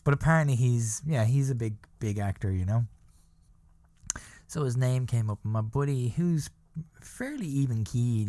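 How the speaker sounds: usual speaking voice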